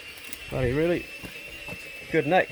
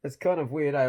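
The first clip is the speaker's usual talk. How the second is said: normal talking voice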